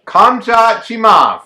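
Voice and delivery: big, drawn-out name announcement, high and loud; announcer-style shout. Acted. Host reveals today's fighter to co-host, drawn-out shout. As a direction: belt out the fighter's name slowly.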